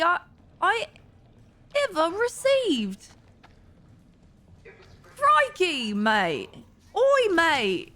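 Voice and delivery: high, swooping mock Australian; hammed-up Aussie that keeps slipping; attempted Australian accent. Shown as a demonstration. Streamer proving she can do Australian, hammed-up Aussie accent. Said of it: "I can do an Australian accent".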